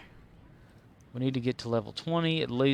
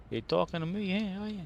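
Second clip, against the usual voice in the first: put-on Bronx accent; 8 semitones higher than usual. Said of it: "With the Bronx accent".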